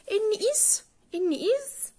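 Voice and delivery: "is" said high and pointed; stress on is. Shown as a demonstration. Teacher to pupils, stressing "is" high. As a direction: point out the word "is". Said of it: "عايزين نلاحظ حاجة صغيرة".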